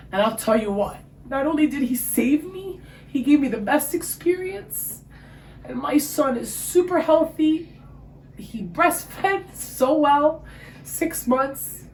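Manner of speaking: high joyful praise; joyful testimony. Real, not acted. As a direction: testify to God's goodness, beaming. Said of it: "testifying about God's help".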